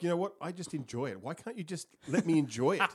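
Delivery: indignant protest. Acted. Complaining to whoever ruined the fun, indignant. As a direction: protest as if your fun is being spoiled.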